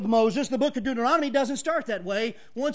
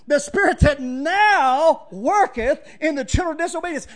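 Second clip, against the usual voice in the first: loud, ringing pulpit proclamation; full-voiced sermon delivery; preaching shout; 3 semitones higher, 5 dB louder than usual. Real, not acted. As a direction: proclaim it over the congregation.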